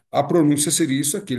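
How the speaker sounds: ordinary delivery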